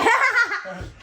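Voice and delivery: high, loud laugh; helpless high laughing; burst of laughter. Real, not acted. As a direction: crack up at your own fumble. Laughing, lost for words.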